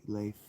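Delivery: Leif said like "layf"; proper pronunciation. Shown as a demonstration. Author shows how Leif should be pronounced, as "layf". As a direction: say Leif with an ay vowel. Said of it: "I should pronounce it like".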